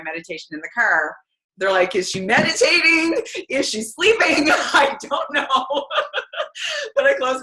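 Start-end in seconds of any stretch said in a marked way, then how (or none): 1.9-4.5 puzzled high quote. Retold or imitated. Quoting puzzled onlookers in car. 4.5-7.4 laughing. Real, not acted. Laughing at the memory.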